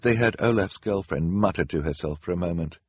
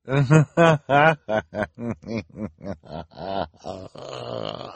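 long, drawn-out villainous cackle; nasty laugh. A narrator's reading. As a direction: laugh with relish at their misfortune. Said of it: "laughed in a particularly nasty way".

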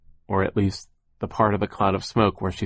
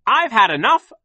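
high, loud, fed-up yell; angry boss's raised voice; 6 dB louder than usual. A narrator's reading. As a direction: shout them down, out of patience. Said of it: "he yelled".

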